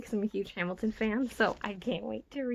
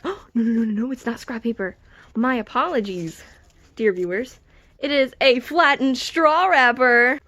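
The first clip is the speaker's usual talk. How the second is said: mock formal apology; 9 dB louder than usual. Vlogger corrects herself to viewers, mock-grand and loud. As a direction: announce it like a grave correction.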